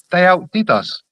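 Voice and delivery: ordinary delivery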